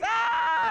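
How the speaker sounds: very high, loud squeal; mock shriek. Three girls going off laughing, shrieking. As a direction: let out a piercing mock squeal.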